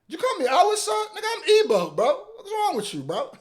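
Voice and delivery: high, indignant mock offense; offended Nigerian. Reactor mimics Nigerian calling out wrong tribe. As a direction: play the insulted Igbo guy.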